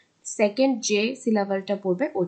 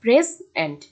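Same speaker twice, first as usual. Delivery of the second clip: Present split in two, "PRE" loud, "zent" dropped; present as a teacher's demo, first syllable hit hard; stress on first syllable; 5 dB louder, slower than usual. Shown as a demonstration. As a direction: punch PRE in present, let the end fall. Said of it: "প্রেসকে একটু জোর দেওয়া হচ্ছে".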